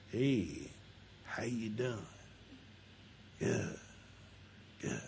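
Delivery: very soft, low, sparse exchange; quiet household. Acted. Wife's quiet parents greeting each other, hushed and low. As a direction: keep it hushed and low, with long gaps. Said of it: "home where everything was quiet".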